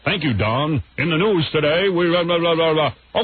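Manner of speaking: lightly voiced mock newsreader; a hint of newscaster, trailing into blah-blah; [name]. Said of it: "here's [name] with the news".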